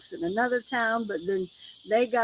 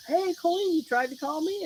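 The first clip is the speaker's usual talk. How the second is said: higher, casual quoted boss on the phone; imitating boss; 7 semitones higher than usual. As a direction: act out the boss calling back. Retold boss returning her call.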